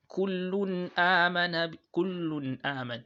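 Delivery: slow chanted "kullun amana", clear n into long "a"; nun without emphasis. Shown as a demonstration. Teacher giving a tanween example, unemphasised n in "kullun aman". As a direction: recite "kullun aman" without leaning on the final n of "kullun". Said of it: "not to lay emphasis on it".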